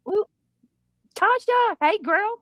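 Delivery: high, happy hello to a friend; excited greeting. Real, not acted. Greeting returning viewer.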